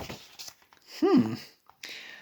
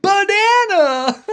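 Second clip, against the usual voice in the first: laughing quote; 8 semitones higher, 5 dB louder than usual. Acted. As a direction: blurt the word out through giggles, higher and louder. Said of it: "The reader said".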